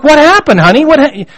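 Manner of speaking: put-on high, puzzled daddy asking his toddler; bewildered parent. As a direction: sound baffled, as if the child just exploded. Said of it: "And you're like".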